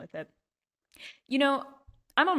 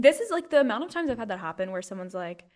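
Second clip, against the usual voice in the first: ordinary talk, nothing marked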